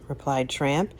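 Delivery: plain reading voice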